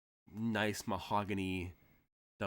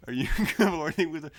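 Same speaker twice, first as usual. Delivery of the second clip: laughing repeat; 7 semitones higher, 10 dB louder, faster than usual. Real, not acted. The game master, at the table, repeats the line loudly while laughing. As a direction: repeat it loud and fast through laughter.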